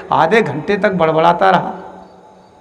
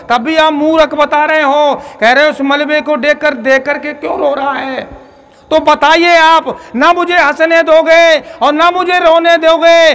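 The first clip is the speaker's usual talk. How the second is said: much higher, louder protest; exasperated outburst; 9 semitones higher, 5 dB louder than usual. Acted. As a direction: complain in frustration. Rich man complains to holy man.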